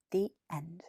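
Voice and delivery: soft whispered the end. A narrator's reading. Narrator closing the story for children, whispered. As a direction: breathe the last words gently. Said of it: "story closing".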